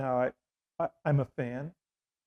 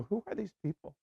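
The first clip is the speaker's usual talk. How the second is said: bewildered; 8 semitones higher, 4 dB quieter than usual. Retold or imitated. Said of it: "I would go".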